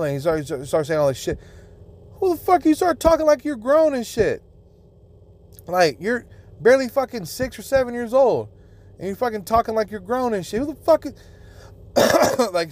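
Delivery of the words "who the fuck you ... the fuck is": much higher, raging disbelief; incredulous outrage. Real, not acted. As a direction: blow up at the idea of it. Outraged rant about kid.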